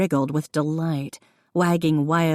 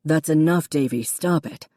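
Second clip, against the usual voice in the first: firm. A narrator's reading. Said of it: "Connor said firmly".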